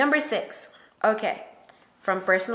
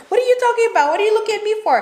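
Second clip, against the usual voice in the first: much higher and louder, indignant; mad husband; 13 semitones higher, 7 dB louder, much faster than usual. Retold or imitated. Husband snapping back at wife's criticism, angry. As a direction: fire back defensively. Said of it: "he gets all mad".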